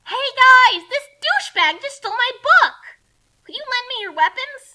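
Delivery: high, loud call. Acted. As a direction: yell across to the guys. Kasumi calls to friends.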